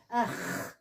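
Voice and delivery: ach said with a throaty kh at the end; Hebrew ach. Shown as a demonstration. Teacher showing students the throaty Hebrew sound of the word. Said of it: "pra dar esse som gargantual aqui".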